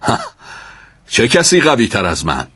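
a touch of swaggering challenge; loud boast. A narrator's reading. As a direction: boast it out to the onlookers. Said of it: "با صدای بلند گفت".

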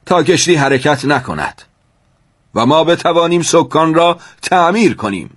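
high, loud commanding voice; captain's orders. A narrator's reading. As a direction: declaim the order to all passengers. Captain gives orders on deck.

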